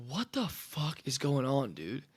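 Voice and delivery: half to yourself, deflated and dazed; stunned disbelief. Retold or imitated. Quarterback back on sideline after pick six, slow soft disbelief.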